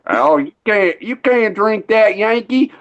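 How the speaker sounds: high, mocking Southern local voice; goofy Southern accent. Locals taunting the Yankee who wants moonshine, in a goofy high Southern accent. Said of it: "They all sound goofy as hell down here".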